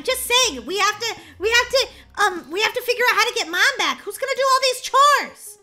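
high, loud, rushed and stammering; frantic. Acted. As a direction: scramble in panic for a plan. Child frantic about the chores.

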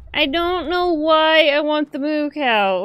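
high, slow goofy whine; silly craving voice. Pretend. Gamer whining to viewers about wanting a cow, goofy high voice. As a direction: play a childish cow-obsessed whim.

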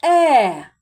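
Е said loud and drawn out; isolated vowel. Shown as a demonstration. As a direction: sound out the vowel Е clearly. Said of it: "Вторий звук".

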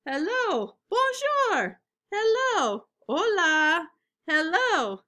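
loud, lively picture-book reading; reading to children. Acted. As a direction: read it big and bright for little kids.

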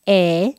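"E" said with a wide smile; smiling E. Shown as a demonstration. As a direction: stretch your lips wide for "E". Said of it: "como una sonrisa".

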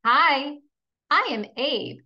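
normal talking voice

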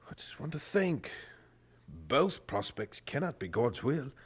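troubled whisper to himself. Acted. Chaplain whispering to himself over the letter. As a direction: breathe the question privately.

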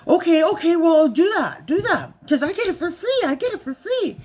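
high, loud insistent repeats; excited husband. Retold or imitated. Wife mimics husband insisting on free deal, loud high. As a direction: push hard, you want it free.

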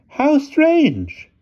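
very high, loud falsetto; puzzled headmistress. A narrator's reading. Headmistress comments to the driver, puzzled falsetto. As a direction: remark in a piercingly high ladylike voice.

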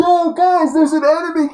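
very high, loud panicked game-character yell; high-pitched mock shout; Sammy. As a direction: squeal out an enemy alert, mocking. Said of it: "that video of Sammy when he's like".

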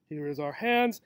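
ordinary delivery

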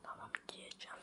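soft unvoiced whisper. Real, not acted. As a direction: whisper the answer secretly. Whispering answer off camera.

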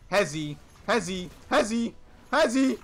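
repeated rising call; urgent calling out. Real, not acted. Calling out to teammate.

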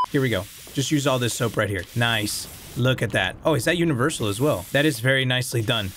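ordinary delivery

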